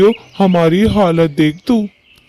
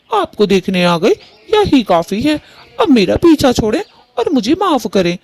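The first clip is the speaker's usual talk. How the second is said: high, sharp daughter-in-law brushing off her mother-in-law; pitched-up bride telling her mother-in-law off; defiant daughter-in-law; 4 semitones higher than usual. Acted. Daughter-in-law refuses to move in with her mother-in-law, high and defiant.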